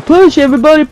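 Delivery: high-pitched mock yell; rallying cry, only half shouted; imitated yelling. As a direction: imitate the bossy guy in chat. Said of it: "He's yelling".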